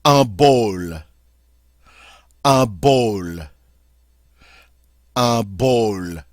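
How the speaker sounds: "Un bol" with a nasal "un" and a short O; French pronunciation. Shown as a demonstration. Teacher drilling "Un bol" for the class. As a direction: repeat "Un bol" clearly, falling each time. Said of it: "that's how we say bowl".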